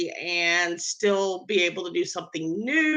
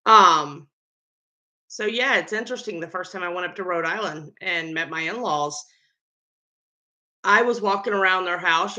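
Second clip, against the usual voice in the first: ordinary delivery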